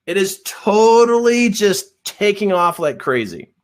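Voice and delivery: high, slow, bragging contractor; enthusiastic contractor. Retold or imitated. The contractor bragging to him over lunch, high and slow. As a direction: sell it big and slow. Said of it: "and he goes".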